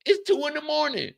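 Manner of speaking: high incredulous whine. Acted. Confused late-night rant.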